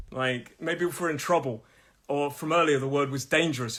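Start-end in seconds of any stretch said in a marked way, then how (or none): none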